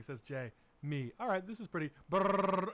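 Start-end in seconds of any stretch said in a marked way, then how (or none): 2.1-2.7 loud glitch buzz. Retold or imitated. Imitating the glitch sound.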